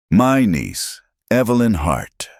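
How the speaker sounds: cracking, strained announcement. A narrator's reading. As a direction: let the voice crack on the name. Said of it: "his voice cracking".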